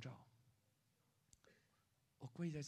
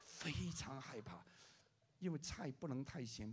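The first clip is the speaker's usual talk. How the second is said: ordinary delivery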